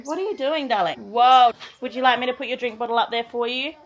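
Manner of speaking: high, loud sing-song mum voice. Real, not acted. Mum talking to toddler.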